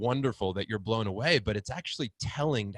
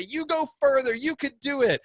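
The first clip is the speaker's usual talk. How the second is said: higher, louder pep talk; rousing encouragement; 5 dB louder than usual. Retold or imitated. Speaker urging someone told they can't, rousing. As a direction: fire them up to keep going. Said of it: "I say".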